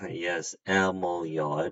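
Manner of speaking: normal talking voice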